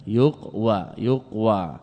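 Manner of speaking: "يقوى" broken as yuq-wa; syllable demonstration. Shown as a demonstration. Teacher isolating the moving waw, "يقوى" split as yuq-wa. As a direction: stop on the q, then sound wa in "يقوى". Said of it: "يقال أصل يقول".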